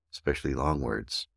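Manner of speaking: ordinary talk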